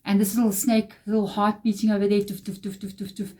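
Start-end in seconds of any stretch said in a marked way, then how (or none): 2.2-3.3 heartbeat sound. Retold or imitated. Mouse's heartbeat imitated.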